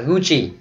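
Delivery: Gucci with a CH sound, the Italian way; Italian pronunciation. Shown as a demonstration. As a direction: say Gucci as "goo-chee". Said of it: "Đọc theo tiếng Ý". ("Gucci" đọc kiểu tiếng Ý, âm CH)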